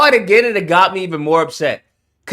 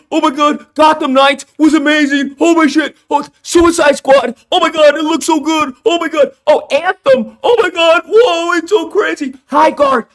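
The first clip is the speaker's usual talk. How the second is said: much higher, louder fake hype; mock a streamer hyping every flop; breathless over-the-top mock enthusiasm; shilling influencer impression; 7 semitones higher, 4 dB louder than usual. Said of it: "they tell everyone how awesome the game is".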